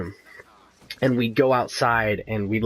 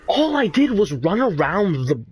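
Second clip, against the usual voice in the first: high exasperated protest; 7 semitones higher than usual. Retold or imitated. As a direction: pitch up in exasperation. Retold protest to the dean.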